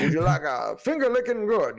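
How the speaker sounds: hammed-up Southern Colonel voice, a touch loud; mock Colonel Southern accent. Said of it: "the Colonel".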